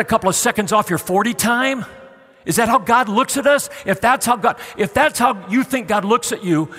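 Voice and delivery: loud, rising sermon cry; impassioned preaching. Real, not acted. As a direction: preach it hard to the congregation. Preaching at full force.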